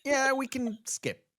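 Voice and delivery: mock producer voice. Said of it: "they was like".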